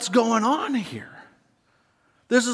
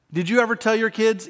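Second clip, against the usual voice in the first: ordinary talk, nothing marked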